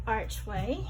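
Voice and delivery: ordinary talk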